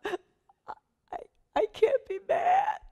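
high, squeaky giggly protest; giddy protest. Retold or imitated. Telling a brother why anger won't come, giddy squeal. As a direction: squeal it helplessly, as if fighting off giggles.

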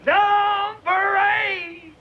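very high, drawn-out holler; yelled out. Acted. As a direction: sing out the call, stretched long. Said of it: "and he yells out".

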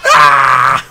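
loud sudden cry of pain; pained shout. Real, not acted. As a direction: yell as you get hit. Getting hit.

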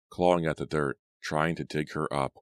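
ordinary delivery

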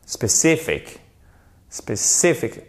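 SPECIFIC with CI punched; stressed second syllable. Shown as a demonstration. Teacher repeats the English word, stress on CI. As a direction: lean on CI in SPECIFIC. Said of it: "on n'a pas l'accent tonique".

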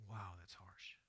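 low, whispered aside; hushed reaction. Real, not acted. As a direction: react under your breath, almost voiceless. Hushed reaction to a hard saying.

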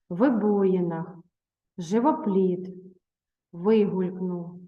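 "вибоїнах", "живопліт", "вигулькнув" said slowly, each stressed syllable clearly marked; careful stress. Shown as a demonstration. Teacher models correct stress in "вибоїнах", "живопліт", "вигулькнув" for pupils. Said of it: "правильно ставте наголос".

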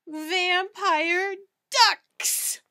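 slow, loud terrified cry; horrified cry. Acted. Cats cry out at vampire ducks, slow and terrified. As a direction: drag out the horror.

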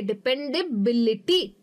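"Dependability" broken up, BIL punched; pause, then hit BIL in "dependability"; dependa-BILity, spelled out for learners; stress on BI. Shown as a demonstration. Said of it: "बे पे मेरा ज़्यादा stress है".

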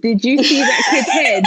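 laughing, loud, high; laughing shout. Real, not acted. Laughing at shocking detail.

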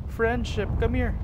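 high-pitched friendly beckoning; high, excited summons; eager calling out. Real, not acted. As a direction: call them over high and eager. Calls out to a kid.

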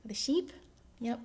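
ordinary delivery, nothing marked

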